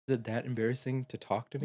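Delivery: low, soft understated plea; retold hurt question. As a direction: lightly act out a vulnerable boy. Retelling boy's vulnerable question.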